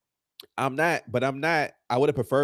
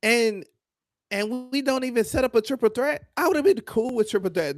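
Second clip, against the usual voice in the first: exasperated outburst; 12 semitones higher, 5 dB louder than usual. Real, not acted. Wrestling podcaster questioning a botched feud, exasperated. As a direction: rant in a high, incredulous voice.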